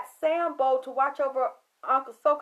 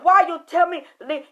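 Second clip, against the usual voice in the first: angry yell; 5 semitones higher, 12 dB louder than usual. Retold or imitated. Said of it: "I said".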